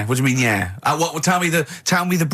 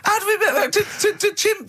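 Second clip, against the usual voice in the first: laughing. Real, not acted. Laughing at the absurd topic.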